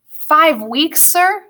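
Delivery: put-on high-pitched, rising boy's question; startled boy. Peter to Grumpkin on the long voyage, startled question. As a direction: ask in a dismayed boy's voice.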